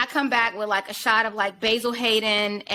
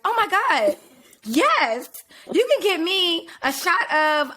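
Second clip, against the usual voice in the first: higher-pitched, hammed-up version of the date; imitating her date; 7 semitones higher, 3 dB louder, slower than usual. Date answers her offer of a drink, hammed-up imitation. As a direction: draw out his drink order in a mock gushing voice. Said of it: "This is literally his words".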